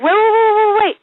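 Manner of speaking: high, rapid string of waits; hammed-up high-pitched stalling; urgent mock pleading. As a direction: plead for a halt in mock alarm. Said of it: "I would just say".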